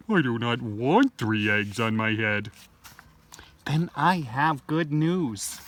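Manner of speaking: usual speaking voice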